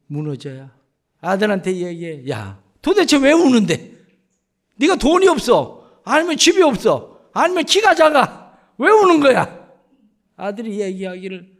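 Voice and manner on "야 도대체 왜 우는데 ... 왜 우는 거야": loud, higher, exasperated yelling; father shouting at his son who keeps coming home crying; angry father. Retold or imitated. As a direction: demand it at your wits' end.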